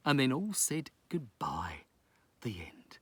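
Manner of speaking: soft hushed close; whispered ending. Acted. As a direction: fade out to a whisper.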